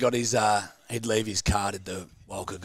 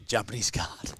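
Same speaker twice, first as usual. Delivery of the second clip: ordinary delivery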